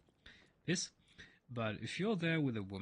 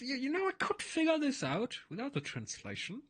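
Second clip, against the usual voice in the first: high, eager bragging; delighted he can read Italian; excited; 9 semitones higher than usual. Real, not acted. Thrilled he understands Italian.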